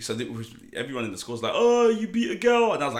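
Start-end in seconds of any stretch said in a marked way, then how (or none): 1.5-2.7 high, loud, drawn-out jeer; mock a playground crowd, slow and high; jeering schoolmates. Schoolmates taunt the boy over the fight, jeering.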